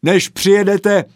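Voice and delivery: high, loud parting threat; parting shout. A narrator's reading. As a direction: yell it back from the gate.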